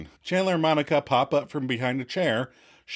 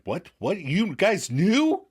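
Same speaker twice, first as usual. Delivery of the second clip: shocked and mad; 7 semitones higher, slower than usual. Retold or imitated. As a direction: stretch it out in betrayed disbelief. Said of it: "he's like".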